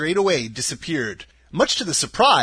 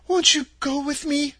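put-on higher girlish voice, begging gently; pleading; 12 semitones higher than usual. A narrator's reading. Dorothy pleading with the witch to come along, higher and begging. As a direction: ask as if she's your only friend. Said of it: "pleaded the girl".

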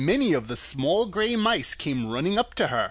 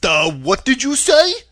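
loud, slow, higher puzzled voice; dopey, drawn-out loud question; Scarecrow; 9 semitones higher, 10 dB louder than usual. A narrator's reading. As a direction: ask it like a baffled straw man. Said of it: "asked the Scarecrow".

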